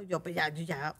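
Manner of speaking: ordinary talk, nothing marked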